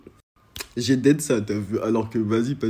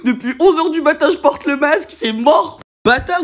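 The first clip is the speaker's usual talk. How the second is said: raised, louder indignant protest, pitch climbing higher; heated prisoner protesting to the cop; getting worked up; 7 semitones higher, 4 dB louder than usual. Retold or imitated. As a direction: refuse outright, louder and higher with each line. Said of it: "moi je commençais à m'exciter".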